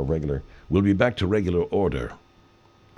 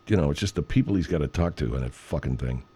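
ordinary talk

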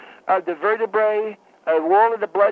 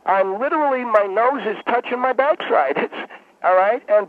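usual speaking voice

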